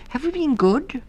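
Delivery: put-on high, hopeful child mouse; little mouse voice. A narrator's reading. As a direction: ask sweetly for praise.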